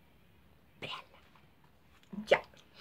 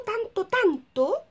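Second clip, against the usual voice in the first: tanto, tanto drawn out slow and high; stretched emphasis; 9 semitones higher, 3 dB louder, much slower than usual. Pretend. Telling viewers about a pushy director, drawn out. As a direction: stretch tanto to show the strain.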